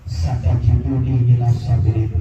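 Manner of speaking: low, calm voice of an obedient son; Ismail answering. Acted. Son answers his father, low and calm. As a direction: speak low and steady, submitting.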